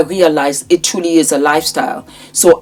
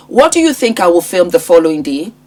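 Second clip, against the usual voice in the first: ordinary delivery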